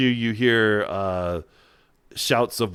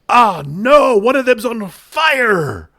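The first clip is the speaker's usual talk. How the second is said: high, loud alarmed cry; panicked sailor; 13 semitones higher, 10 dB louder, slower than usual. Acted.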